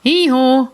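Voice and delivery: loud high hee-haw bray; loud donkey hee-haw. Acted. Donkey noise in picture book.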